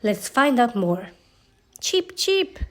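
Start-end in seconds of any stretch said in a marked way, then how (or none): 1.8-2.6 high tiny cheeping chick. Acted. Newborn chick greeting its mother with a cheep. Chick imitates its own call.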